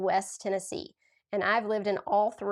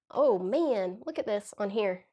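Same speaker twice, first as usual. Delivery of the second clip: ordinary delivery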